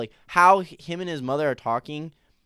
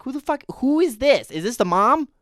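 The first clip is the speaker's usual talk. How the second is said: shocked; 11 semitones higher, 7 dB louder than usual. Retold or imitated. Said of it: "I'm like".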